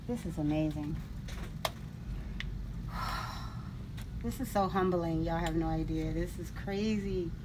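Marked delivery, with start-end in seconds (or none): 1.0-4.2 soft, shaky breath out to steady herself; emotional sigh. Real, not acted. Overwhelmed by her product in a store, a tearful sigh. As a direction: let out a moved, tearful sigh. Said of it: "trying so hard not to cry".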